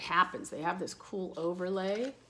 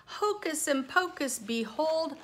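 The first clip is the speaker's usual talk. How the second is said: magical flourish; 7 semitones higher, 4 dB louder, slower than usual. A narrator's reading. Magic words in story.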